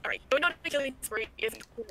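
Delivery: choppy robotic filtered voice; half robot voice. Real, not acted. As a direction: voice breaking up like a robot. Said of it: "still robotic".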